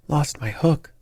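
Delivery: mildly fierce outburst of disbelief; fierce cry. A narrator's reading. Elder brother rounds on the brother who lost his hook, mildly fierce. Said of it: "cried out fiercely".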